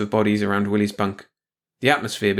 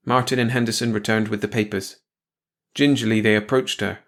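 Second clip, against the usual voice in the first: plain reading voice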